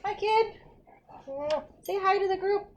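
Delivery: high-pitched cooing to a pet; pet talk. Real, not acted. Host shows Chewy to the group, cooing. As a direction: greet a beloved pet in a high voice.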